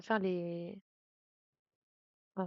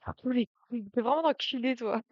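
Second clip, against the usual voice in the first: louder, playful outburst; mock angry insult; 3 semitones higher, 6 dB louder than usual. Real, not acted. Streamer cursing the game character, mock angry. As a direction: swear at the game, half-joking.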